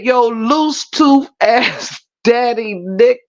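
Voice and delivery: slow quote dissolving into sobbing laughter; helpless, tearful laugh on the last words; crying with laughter. Real, not acted. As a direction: drag out the line, then crack up. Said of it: "wet my face is right now from crying".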